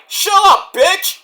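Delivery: high, loud angry snap. Retold or imitated. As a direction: tell her off hard. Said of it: "And then, like".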